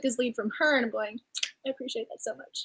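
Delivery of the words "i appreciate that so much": a soft, near-whispered thank you; a quiet, heartfelt aside to the book; hushed gratitude. Retold or imitated. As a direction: say it hushed and touched. Gushing reader reaction to hero.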